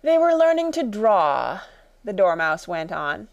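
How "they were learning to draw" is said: yawning, sleepy. A narrator's reading. Dormouse resuming its story while sleepy, yawning. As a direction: stretch it out high, drowsily. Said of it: "yawning and rubbing its eyes".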